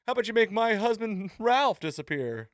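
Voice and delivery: high, loud, hammed-up wisecrack; imitating a cheeky neighbour; mock sassy Agnes. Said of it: "And she's like".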